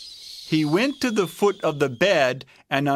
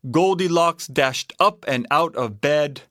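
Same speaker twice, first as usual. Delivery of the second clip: ordinary delivery, nothing marked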